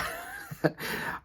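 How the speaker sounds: high squeaky giggle. Real, not acted. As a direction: laugh at yourself. Laughs at her own boast.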